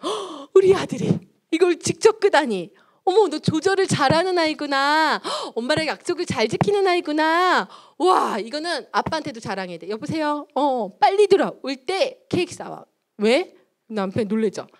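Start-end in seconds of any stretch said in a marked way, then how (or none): 0.0-9.9 over-the-top praise. Acted. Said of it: "우리 엄마 왜 이렇게 오버하지". 9.9-12.8 higher-pitched gleeful mock call home; excited phone call.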